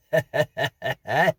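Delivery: loud high chuckle of a jolly grocer; jovial grocer laugh; hearty laugh. Acted. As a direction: laugh at your own joke. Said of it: "grocer's parting laugh".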